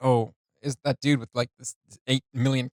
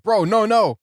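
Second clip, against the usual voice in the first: higher, louder mock plea; Slim begging; 8 semitones higher, 8 dB louder, slower than usual. Retelling the song, Slim begs in a high mock plea. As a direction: plead with him in a panicked squeak. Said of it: "And Slim's like".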